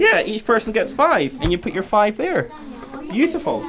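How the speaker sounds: high-pitched classroom voice; teacher to class. Real, not acted.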